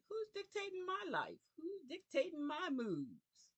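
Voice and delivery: high, sharp indignant question; indignant outcry. Retold or imitated. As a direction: cry out who is running your life. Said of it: "I said".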